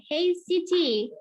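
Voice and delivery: high, drawn-out call. Shown as a demonstration. Teacher reads the example as a call to Siti, calling out. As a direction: call out to get Siti's attention.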